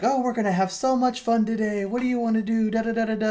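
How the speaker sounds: put-on very high, sing-song babysitter voice; chirpy animatronic babysitter. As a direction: gush like an overexcited robot nanny. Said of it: "And he's all like".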